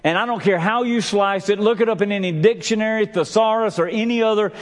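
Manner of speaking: high-pitched, emphatic sermon; full-force preaching. Acted. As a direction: build to high, ringing calls and drive each point home.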